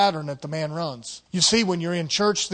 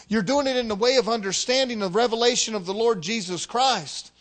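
ordinary talk

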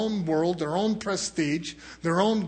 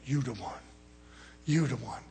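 devil whispering flattery; 5 semitones lower, 9 dB quieter than usual. Retold or imitated. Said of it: "the devil sit there and say".

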